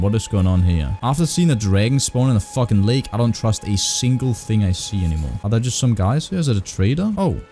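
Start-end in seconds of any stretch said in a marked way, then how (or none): none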